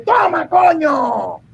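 hammed-up macho shout; mock shout. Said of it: "Dice el tipo".

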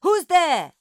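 loud, high challenge; ringing, high-pitched shout. A narrator's reading. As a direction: shout out to an unseen intruder. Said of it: "A shout rang out outside".

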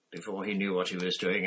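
ordinary delivery, nothing marked

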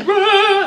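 retold roar. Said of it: "Just like".

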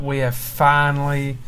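ordinary talk

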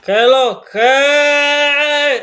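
high, drawn-out hollered hello; big high-pitched greeting shout. Real, not acted. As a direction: holler it slowly, stretching the last word. Hearty greeting opening the podcast.

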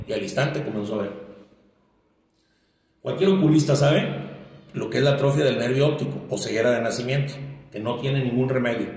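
ordinary talk, nothing marked

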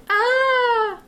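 long, high-pitched mock wail; exasperated wail. Retelling exasperation at chattering moviegoers.

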